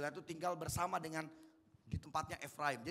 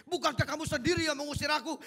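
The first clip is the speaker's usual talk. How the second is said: higher and louder, angry accusing demo; angry reading; 8 semitones higher, 7 dB louder than usual. Shown as a demonstration. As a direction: fire the line back in anger. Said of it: "kalau kira-kira Yefta marah, mesti ngomongnya gini".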